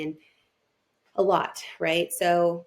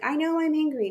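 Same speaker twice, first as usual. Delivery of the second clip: higher-pitched retold exasperation; frustrated; 9 semitones higher, 3 dB louder than usual. Mother venting to her friend who is also a colleague, frustrated. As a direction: replay the frustration, pitched well up. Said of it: "telling her that I was frustrated".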